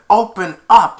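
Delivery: loud, high barked order; mock guard yell; guard's shout. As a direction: pound on the door and demand. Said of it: "banged on the door and said".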